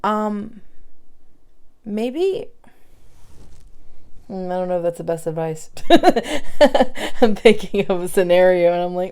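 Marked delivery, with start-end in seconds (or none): 5.7-7.2 high, loud burst of laughter; loud sheepish laugh. Real, not acted. Doubting her own advice, a loud embarrassed laugh. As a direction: laugh at yourself.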